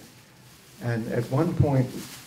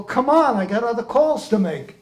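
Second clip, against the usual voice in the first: acted impatience; 8 semitones higher than usual. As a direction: hurry a dawdler along, mock exasperated. Acts genie hurrying the dreamer.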